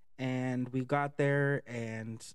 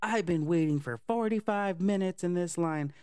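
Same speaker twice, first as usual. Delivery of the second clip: mock tantrum complaint; 5 semitones higher, 3 dB louder than usual. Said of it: "throwing a fucking tantrum and was like".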